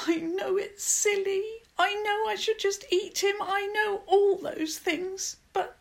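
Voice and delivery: put-on high-pitched, plaintive girl's voice; high pleading whimper, easing towards the end. A narrator's reading. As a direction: whimper out a helpless confession. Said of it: "She whimpered".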